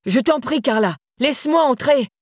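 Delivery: high, desperate pleading. A narrator's reading. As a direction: plead to be let in. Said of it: "désespéré".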